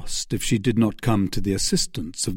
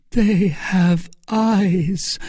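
slow, high, grief-laden voice; grieving; 9 semitones higher, much slower than usual. A narrator's reading. Milady lamenting to Felton, slow and grieving. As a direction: let the grief drag each word. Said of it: "with an accent of indescribable grief".

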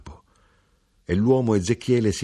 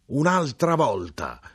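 yelled; 8 semitones higher, slower than usual. A narrator's reading. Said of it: "urlò".

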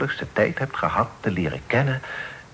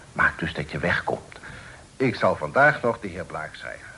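normal talking voice

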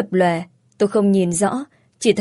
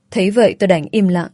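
usual speaking voice

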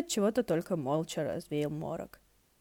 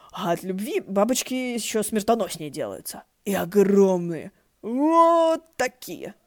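hammed-up storyteller; 2 semitones higher, 8 dB louder, slower than usual. Storyteller hams up the tale.

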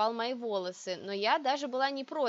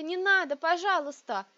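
high, louder desperate plea; louder pleading; 7 semitones higher, 6 dB louder than usual. A narrator's reading. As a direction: beg harder, raising the voice. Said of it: "молила только об одном".

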